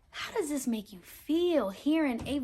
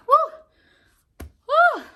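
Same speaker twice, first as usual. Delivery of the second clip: much higher, louder whoops; excited woo; 11 dB louder than usual. Acted. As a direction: cheer the racing family.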